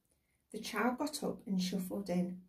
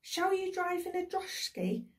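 put-on very high child's voice; child calling out; 10 semitones higher than usual. A narrator's reading. Another child calls to departing father, child's cry. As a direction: pipe up high with a child's question. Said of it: "cried another".

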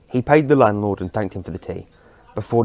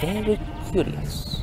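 put-on slow, much higher, quieter eccentric old man; intrigued old man; 10 semitones higher, 4 dB quieter, slower than usual. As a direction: savour the mystery, drawn out.

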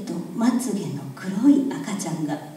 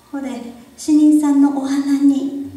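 put-on young girl voice; 6 semitones higher, 9 dB louder, slower than usual. A narrator's reading. Girl voiced, high, loud.

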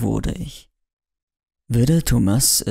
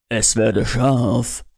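mock solemn; much slower than usual. Mock solemn command, glasses on.

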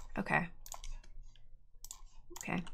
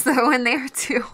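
normal talking voice

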